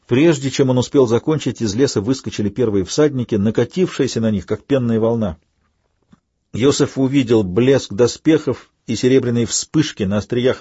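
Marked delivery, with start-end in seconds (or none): none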